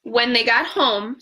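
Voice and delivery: ordinary delivery, nothing marked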